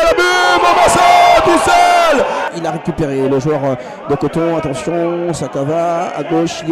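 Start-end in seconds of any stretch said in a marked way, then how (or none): 0.1-2.2 shouted goal call, high; excited sports commentary. Real, not acted. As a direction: yell it out, thrilled.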